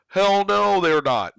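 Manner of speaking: loud, high, emphatic denial. Real, not acted. Coin vlogger answering his own question, emphatic loud denial. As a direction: throw out a big "hell no".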